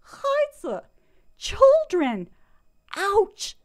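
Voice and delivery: high, loud, emphatic exclamation; mock horror. Shown as a demonstration. Poet confessing she said children instead of buns, mock horror. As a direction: punch each word out high in mock dismay. Exclaiming over own embarrassing gaffe.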